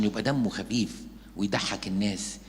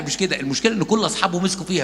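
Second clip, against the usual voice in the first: ordinary delivery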